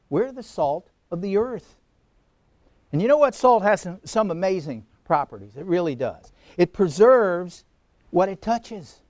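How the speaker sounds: ordinary talk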